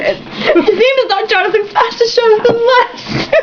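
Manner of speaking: high, slow correction through laughter; laughing correction. Real, not acted. Reader correcting a friend's joke, laughing high. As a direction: correct the name while laughing. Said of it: "correcting friend while laughing".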